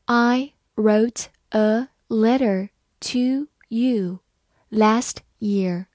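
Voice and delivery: slow, word by word; slow reading. Shown as a demonstration. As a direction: read it slowly with each word set apart. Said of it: "好，慢慢来读". (慢慢读，一词一顿)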